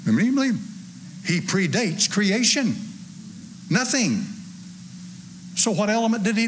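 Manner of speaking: high, ringing preacher's voice; rising pulpit fervour; fervent preaching. Acted. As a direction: preach it up to the rafters. Raised preaching on God's nature.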